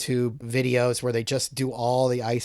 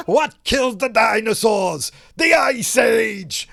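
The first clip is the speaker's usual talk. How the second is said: booming villain pun, much higher and louder; Mister Freeze shout; 10 semitones higher, 7 dB louder than usual. Retold or imitated. Host doing Mister Freeze blasting the dinosaur, booming shout. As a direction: roar the pun like a gleeful supervillain. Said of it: "and goes".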